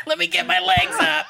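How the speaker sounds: high, laughing drag diva; sassy drag queen. Pretend. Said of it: "my drag queen name".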